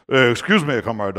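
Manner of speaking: put-on low, half-hearted Russian accent; attempted Russian accent. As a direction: lightly imitate a Russian guard's accent. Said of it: "I don't speak Russian accents".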